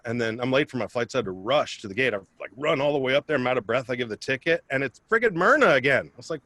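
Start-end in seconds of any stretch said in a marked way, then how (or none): none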